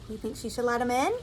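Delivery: usual speaking voice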